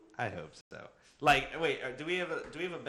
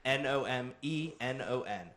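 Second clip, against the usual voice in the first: ordinary delivery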